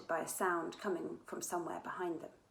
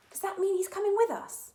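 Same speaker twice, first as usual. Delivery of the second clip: surprised; 13 semitones higher, 7 dB louder than usual. Acted. Surprised Cassandra.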